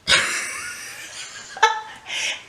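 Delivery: breathy high burst of laughter; can't help laughing at the timing; real laugh. Real, not acted. Laughing at the timing of the death. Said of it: "And I don't mean to laugh".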